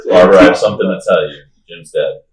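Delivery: fast, laughing mock line. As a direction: blurt it out between laughs. Mocking the Batman scene.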